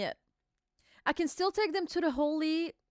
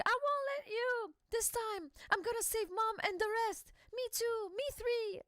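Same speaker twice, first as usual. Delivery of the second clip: put-on high, soft little children's voices; small kids bravely promising to save mom; children vowing to protect their mom; kids' voices; 10 semitones higher, 7 dB quieter than usual. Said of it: "He's like".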